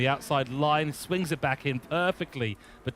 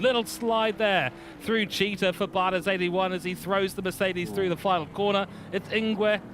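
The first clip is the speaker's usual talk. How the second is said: raised, lively motorsport call; excited play-by-play; 6 semitones higher than usual. Real, not acted. Commentator following a car through the final corner, excited. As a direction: call the action with sudden urgency.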